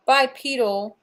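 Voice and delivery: usual speaking voice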